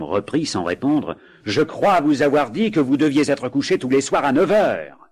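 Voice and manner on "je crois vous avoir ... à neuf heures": stern ghost. Acted. Ghost scolds the couple.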